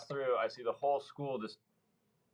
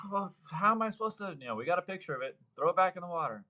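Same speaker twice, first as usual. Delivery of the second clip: reluctant whine; 8 semitones higher, faster than usual. Retold or imitated. Grudging protest.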